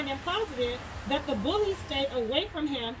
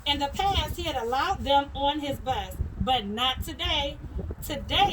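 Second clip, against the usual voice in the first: ordinary delivery, nothing marked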